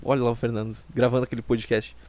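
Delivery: a hint of disappointed parents grumbling about their son. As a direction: mutter it like parents sighing over their son. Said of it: "Eles devem acordar todos os dias e pensar".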